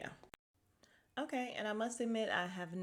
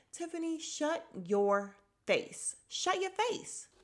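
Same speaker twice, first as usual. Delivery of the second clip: slow, drawn-out telling-off; exaggerated, slow exasperated order; mock scolding; 10 semitones higher, slower than usual. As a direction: stretch each word, climbing high at the end. Drawn-out playful scolding of Tiffany.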